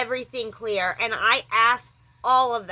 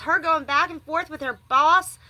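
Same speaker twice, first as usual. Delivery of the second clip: ordinary talk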